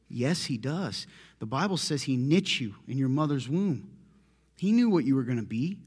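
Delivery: ordinary talk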